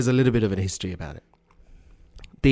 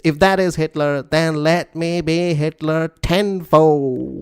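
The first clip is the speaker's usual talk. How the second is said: put-on drawn-out, higher dictator-accent oratory; Robert Mugabe; 5 semitones higher, 3 dB louder, slower than usual. Co-host carries on the leader's speech, dictator accent. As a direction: declaim each phrase slowly in a dictator accent, building to the last word. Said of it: "it's just my regular dictator accent".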